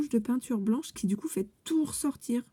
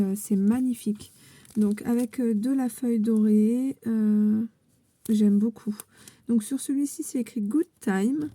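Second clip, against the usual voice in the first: ordinary talk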